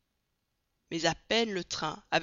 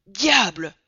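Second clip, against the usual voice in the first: exclamation forte et brève; juron surpris; 10 dB louder than usual. A narrator's reading. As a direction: Passepartout stupéfait lâche un juron. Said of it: "fit Passepartout". (juron stupéfait)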